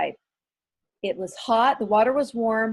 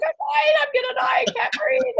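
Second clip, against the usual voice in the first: mock hysterical shout of I can't breathe; retold panicked yell. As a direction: wail it like you're drowning. Retold screaming at instructor.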